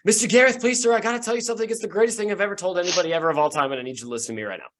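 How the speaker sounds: put-on high, fast, eager boy; breathless excited youngster; cheerful kid voice. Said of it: "you hear a cheerful voice go".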